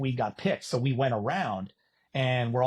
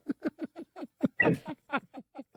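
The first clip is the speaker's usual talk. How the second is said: high burst of laughter; 13 semitones higher, 4 dB quieter than usual. Real, not acted. Laughing while telling friends the story. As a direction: laugh out at the memory.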